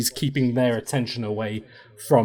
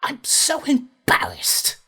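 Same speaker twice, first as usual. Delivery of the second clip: high, whispered elf sister, mortified; fancy elf voice. Acted. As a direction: hiss it high and ashamed like an elf noble. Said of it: "fancy elf voice".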